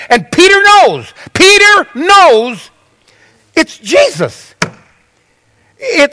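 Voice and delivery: high, breathless build of a sermon story; impassioned preaching. A narrator's reading. Preacher tells congregation of Peter recognising Jesus, impassioned preaching. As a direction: preach it with mounting excitement.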